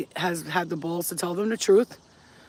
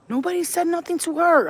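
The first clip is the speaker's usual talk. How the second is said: much higher, louder disbelief; incredulous; 11 semitones higher, 6 dB louder than usual. Real, not acted. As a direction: repeat it in sheer disbelief. Incredulous repeat of her point.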